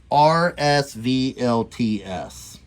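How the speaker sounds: slow letter-by-letter spelling. Shown as a demonstration. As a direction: spell each letter slowly. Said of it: "and that's spelled".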